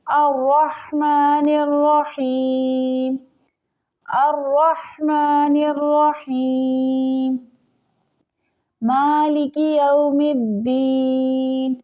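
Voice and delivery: slow melodic tajweed chant; Quranic recitation. Shown as a demonstration. Teacher demonstrating the recitation to students, slow melodic chant. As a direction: draw each word out in a measured chant.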